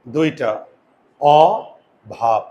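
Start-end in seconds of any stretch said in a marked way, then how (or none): 1.2-2.4 অভাব split slowly into "aw... bhab"; long pause after the open অ in অভাব; teacher breaking অভাব into two syllables; syllable breakdown. Shown as a demonstration. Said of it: "syllable ভাঙা শিখো".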